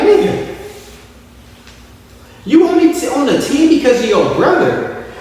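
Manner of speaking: mock retort.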